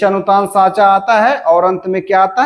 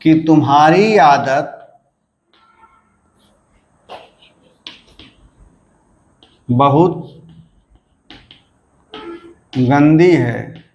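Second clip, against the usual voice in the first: slow, drawn-out example with pauses, ending low on है; तुम्हारी आदत level, बहुत गंदी raised, है dropping, spoken slowly; demo of sentence tune; 3 semitones lower, slower than usual. Shown as a demonstration. Teacher giving a second intonation example to students, slow and spaced. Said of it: "उसी प्रकार क्या है".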